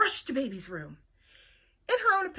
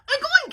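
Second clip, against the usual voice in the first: playful oinks; 10 dB louder than usual. Acted. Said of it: "said Mercy".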